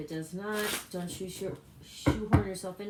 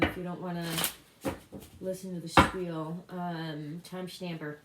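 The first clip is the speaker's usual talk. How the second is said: ordinary talk, nothing marked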